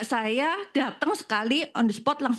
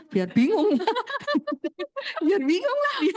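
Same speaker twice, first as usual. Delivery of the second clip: high-pitched, real laughing delivery; sambil tertawa; 10 semitones higher than usual. Real, not acted. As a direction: break into laughter at your own story. Tertawa menceritakan satpam bingung. (bicara sambil ketawa geli, nada tinggi)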